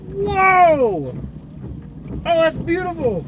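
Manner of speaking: high, loud awestruck shouting; breathless shouting over wind. Real, not acted. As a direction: shout in awe at the storm. Storm chaser sees clear slot.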